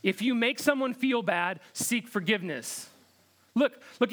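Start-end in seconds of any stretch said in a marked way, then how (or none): none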